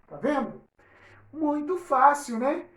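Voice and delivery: ordinary talk, nothing marked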